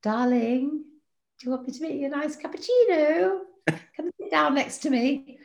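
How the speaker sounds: high, syrupy sweet wife; high, overly sweet darling voice; sugary wife voice. Retold or imitated. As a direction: coo it to your husband, all honey. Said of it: "the, uh, Karen way".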